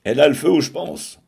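fast, loud, high sneering jab; mocking gossip. A narrator's reading. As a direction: snigger it out at her expense. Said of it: "opinaient les autres".

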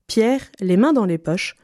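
ordinary delivery, nothing marked

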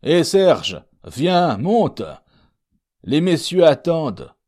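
high, loud call from the doorway; cri d'appel. A narrator's reading. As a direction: call Serge up, loud and urgent. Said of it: "cria à la porte le maître de poste". (appel crié, haut et fort)

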